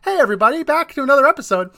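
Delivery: high, loud host greeting, like someone else; airy second voice. Shown as a demonstration. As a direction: pretend to be a different, airier voice actor. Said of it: "make my voice airy so you thought it was a different person".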